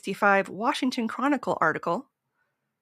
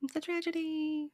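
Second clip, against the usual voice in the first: mock dramatic; 8 semitones higher, 6 dB quieter than usual. Echoing in mock gravity.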